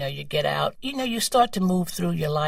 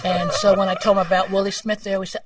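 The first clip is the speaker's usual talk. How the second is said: ordinary delivery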